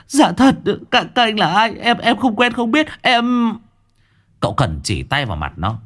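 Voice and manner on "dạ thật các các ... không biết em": high, panicked stammering; frightened stammer. Acted. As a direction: stutter in terror, begging. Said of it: "gật đầu rối rít". (giọng lắp bắp sợ hãi)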